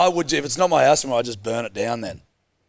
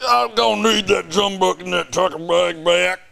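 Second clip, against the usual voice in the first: an 'Australian' that comes out American, higher and slow; hammed-up cowboy American, pitched up and slow; mock Australian accent; 7 semitones higher, slower than usual. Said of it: "More Australian".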